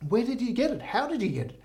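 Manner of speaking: high-pitched acted amazement; eager Pope. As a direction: ask in delighted wonder, pitch up high. Pope eagerly asks about fish.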